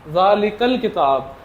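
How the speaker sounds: ज़ालिकल किताब slowly, the hamza skipped; slow model reading of ज़ालिकल किताब; slow joined reading. Shown as a demonstration. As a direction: draw out ज़ालिकल किताब as one smooth phrase. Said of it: "तो किस तरह पढ़ूंगा?".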